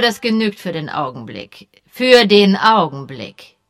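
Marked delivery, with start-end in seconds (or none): 1.9-3.6 slow, weighty threat; menacing. A narrator's reading. Colonel warning the suspect, slow and menacing. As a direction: lean on every word as a warning. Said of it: "wiederholte Colonel Melchett drohend".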